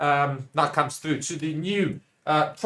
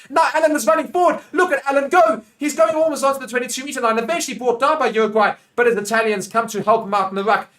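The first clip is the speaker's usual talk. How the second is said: high, urgent commentary on a running break; animated live rugby call; excited play-by-play; 6 semitones higher, 5 dB louder than usual. Real, not acted. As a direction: ride the run upward, then ease off.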